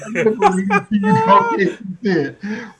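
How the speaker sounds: high loud cackling laugh; laughing burst. Real, not acted.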